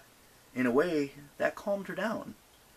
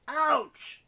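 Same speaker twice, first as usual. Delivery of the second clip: ouch. Acted. Hit in scuffle.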